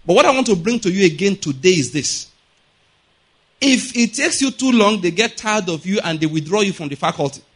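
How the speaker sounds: ordinary delivery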